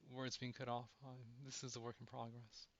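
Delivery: ordinary talk, nothing marked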